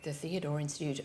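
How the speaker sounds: low, soft, bored repeat. Retold or imitated. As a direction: repeat it like a tired receptionist. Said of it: "she said in a kind of bored voice".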